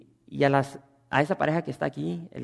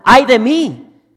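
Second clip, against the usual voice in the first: high, slow, very loud anguished cry; shouting; 9 semitones higher, 17 dB louder, much slower than usual. Shown as a demonstration. Preacher shows how Isaiah cries out, shouting. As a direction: wail it out like a man in despair. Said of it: "lo dice gritando".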